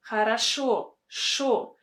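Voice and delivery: "Хорошо" with weight on SHO, then "Шо" alone; ha-ra-SHO, stressed syllable repeated on its own as "Шо"; stress on last syllable. Shown as a demonstration. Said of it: "stress on the last syllable".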